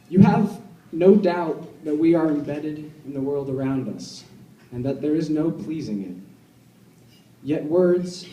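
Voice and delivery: measured, deliberate poem recital with long pauses; poetry reading. Acted. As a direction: read it line by line, letting each image hang. Reads second poem aloud.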